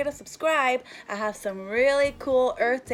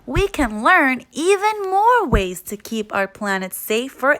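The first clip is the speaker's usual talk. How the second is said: put-on children's story read-aloud; 7 dB louder than usual. Child talking to puppy Biscuit in a picture book, storytime voice. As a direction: read to little ones with lilting emphasis.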